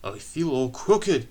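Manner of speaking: put-on a touch of weary old engine; complaining. A narrator's reading. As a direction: complain slowly. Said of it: "he complained".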